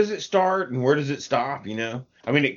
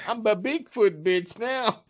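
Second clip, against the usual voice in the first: mock dazed voice; 8 semitones higher, slower than usual. Mock voice after laughing.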